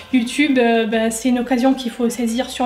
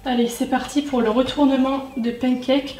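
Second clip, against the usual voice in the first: normal talking voice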